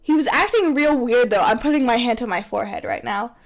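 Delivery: ordinary talk, nothing marked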